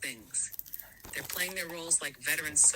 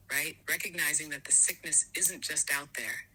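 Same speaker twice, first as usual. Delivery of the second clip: usual speaking voice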